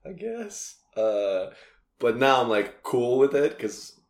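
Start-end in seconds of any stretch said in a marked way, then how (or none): 0.0-0.7 unsure high thanks, held soft; high, soft awkward thanks. Retold or imitated. Comic unsure of praise, high soft awkward thanks.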